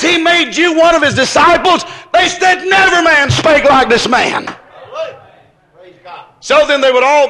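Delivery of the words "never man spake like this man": ordinary talk, nothing marked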